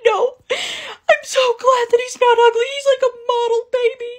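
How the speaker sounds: very high, loud squeaky wail; mock happy sobbing. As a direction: bawl it out in a hammed-up squeal of relief. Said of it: "I kept crying and crying".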